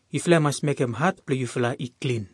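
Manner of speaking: ordinary talk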